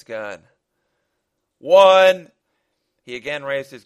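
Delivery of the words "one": shouted final count, high; countdown. Acted. Gunman at the end of countdown, loud final warning. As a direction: shout the last number, drawn out. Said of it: "the leader counted down".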